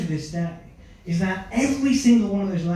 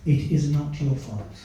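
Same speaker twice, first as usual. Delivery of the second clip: repeated soothing; 6 semitones lower, 7 dB quieter than usual. Retold or imitated. Father soothing his son about the divorce, softly repeated. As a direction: soothe him over and over, gently. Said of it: "He kept saying it over and over again".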